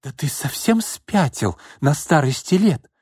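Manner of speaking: high indignant rebuke; outraged wife. Acted. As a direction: scold him in disbelief.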